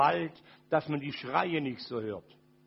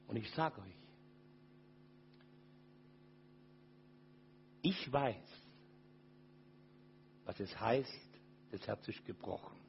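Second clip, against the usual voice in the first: much lower and softer, near tears; very soft and lower, grieving; broken-hearted; 8 semitones lower, 7 dB quieter than usual. Real, not acted. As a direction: let it sink into a hushed grief. Heartbreak confession, voice fading.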